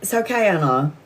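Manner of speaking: low, soothing self-talk; zen self-calming. Retold or imitated. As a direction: talk yourself down into calm. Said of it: "And I'm like, "Zen".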